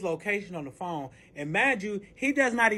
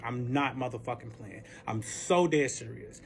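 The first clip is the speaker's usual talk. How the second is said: low, hushed retold threat; dead-serious warning; 8 semitones lower, 6 dB quieter than usual. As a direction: drop low and quiet to warn her. Retold warning to the other woman.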